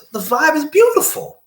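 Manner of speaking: high, sing-song praise of the mood; dreamy delight. Retold or imitated. To the listener, savoring the happy day: high dreamy delight. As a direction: float up on beautiful.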